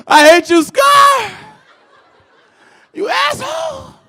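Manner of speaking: high, loud, sobbing yell at Scar; hammed-up grief, screaming; tearful shout. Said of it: "I'm like".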